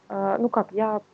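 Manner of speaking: normal talking voice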